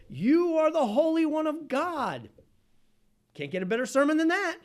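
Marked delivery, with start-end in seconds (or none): 0.1-2.3 loud, high, drawn-out proclamation; slow, high-pitched shouted declaration; preaching demon shout. Acted. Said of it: "The demon begins to preach".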